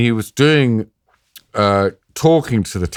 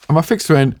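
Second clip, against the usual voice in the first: ordinary talk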